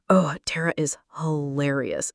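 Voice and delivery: ordinary delivery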